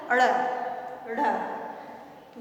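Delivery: अड़ with a flapped tongue, then ढ़ breathy and flapped; retroflex flap sounds. Shown as a demonstration. Teacher showing the class अड़ and ढ़, flapped. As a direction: flick the tongue forward for अड़ and ढ़. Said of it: "जब इसका उच्चारण क्या होता है".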